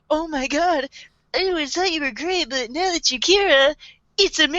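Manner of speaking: high, adoring mock gush; lightly hammed-up swooning; gushing fangirl. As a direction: gush like a starstruck admirer. Acting the gushing admirer.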